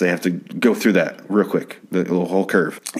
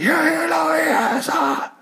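strained, high, hushed mock scream; held-back yell; much slower than usual. Imitating the caller's epic freak-out while girlfriend sleeps, held-back yell. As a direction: yell it epically without waking anyone. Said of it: "I can't yell that much 'cause my girlfriend is sleeping".

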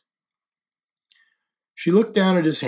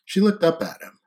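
plain reading voice

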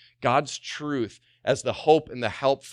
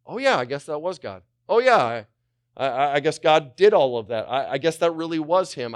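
raised, loud sheepish admission; belated realisation; 4 semitones higher, 6 dB louder than usual. Acted. Forgetful believer crediting God late, mock sudden realisation. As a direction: concede it haltingly, pitch lifted.

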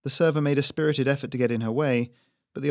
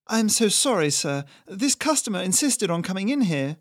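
high, hurried apologetic waiter; babbling waiter; 8 semitones higher, 3 dB louder than usual. Acted. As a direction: gush excuses to the chef. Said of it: "the server babbled".

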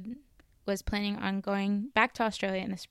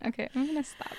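usual speaking voice

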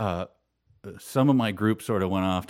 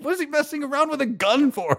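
very high, baffled disbelief; can't believe anyone would joke with a gun; a lightly voiced baffled friend; incredulous friend.

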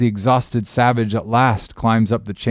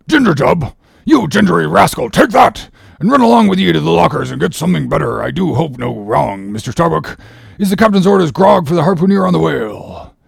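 scornful sailor; 6 semitones higher, 4 dB louder than usual. Acted. Stubb berates Doughboy.

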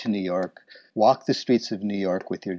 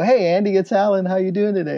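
upbeat greeting pitched high, louder at the start; friendly drop-in hello; 10 semitones higher, 4 dB louder, faster than usual. Retold or imitated. Alan greets art director Andy at his office door, upbeat and high. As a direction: pop your head in the door like an old friend. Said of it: "office and go".

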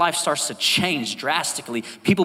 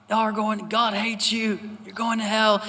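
imitating a street preacher's yell; 8 semitones higher than usual. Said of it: "he's just yelling".

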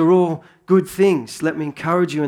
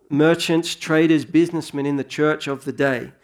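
ordinary talk, nothing marked

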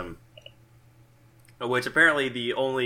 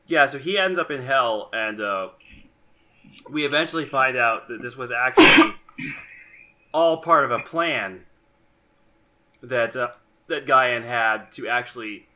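hiccuping mid-sentence; talking through hiccups; 5 dB louder than usual. Real, not acted. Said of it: "I'm sorry, I'm hiccuping".

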